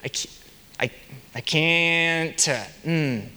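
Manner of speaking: halting, stuttered start; a faltering, reluctant admission; hesitant. Shown as a demonstration. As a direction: struggle to get the words out. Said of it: "You almost hesitated".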